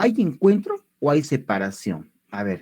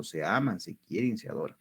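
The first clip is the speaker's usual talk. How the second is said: tender joke; 6 semitones lower, 10 dB quieter than usual. Acted. Teacher jokes vowels love each other.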